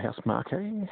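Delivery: Housemarque said as "house-mar-kay"; alternative pronunciation. Shown as a demonstration. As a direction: end Housemarque on a "kay" syllable. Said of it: "I don't know how it would be pronounced".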